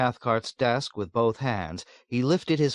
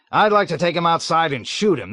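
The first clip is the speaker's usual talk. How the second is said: high, loud, vindictive; angry threat; 8 semitones higher, 6 dB louder than usual. A narrator's reading. Colonel threatening to shoot the captain. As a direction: spit out the threat, louder.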